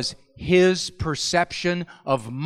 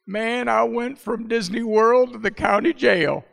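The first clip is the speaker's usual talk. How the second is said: a lightly voiced little boy, higher and laughing; son's dismayed reaction; 5 semitones higher than usual. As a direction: play the boy's comic shock at bedtime. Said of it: "You should have seen the look in his face".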